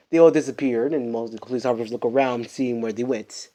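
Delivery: usual speaking voice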